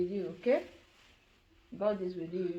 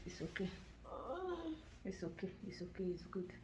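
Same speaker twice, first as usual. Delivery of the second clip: murmured, much softer reassurance; soft soothing; 10 dB quieter, faster than usual. Real, not acted. Mother calming her groggy son, soft murmur. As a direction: hush him gently back to rest.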